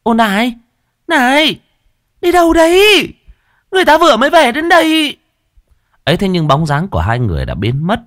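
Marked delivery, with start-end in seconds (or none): 0.0-5.2 high shrill calling out; calling after. Acted. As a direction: call after them, higher each time. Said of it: "gọi với".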